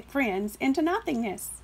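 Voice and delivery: usual speaking voice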